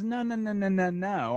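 high, mock waving-away refusal; imitating a hasty overruling; dismissive refusal. Acts out Tullius rejecting wooden swords.

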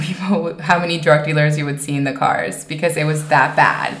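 usual speaking voice

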